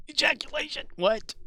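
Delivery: high, loud startled yelp; mock shocked outburst. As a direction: blurt it out like a shocked cry. Sudden outburst while reading.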